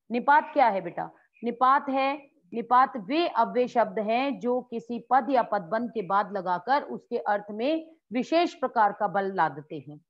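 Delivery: ordinary delivery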